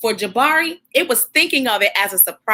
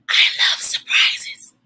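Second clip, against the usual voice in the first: whisper; slower than usual. A narrator's reading. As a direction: breathe the words out secretly. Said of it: "he whispered".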